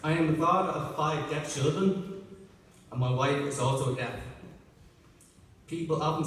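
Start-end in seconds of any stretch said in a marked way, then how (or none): none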